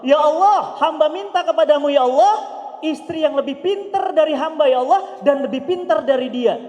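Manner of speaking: loud fervent prayer. Retold or imitated.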